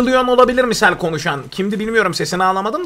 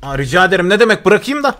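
ordinary talk

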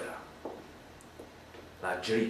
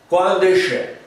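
higher, louder demand; angry demand; 6 semitones higher, 5 dB louder, slower than usual. Acted. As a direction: bark the question. Lui chiede arrabbiato. (domanda arrabbiata)

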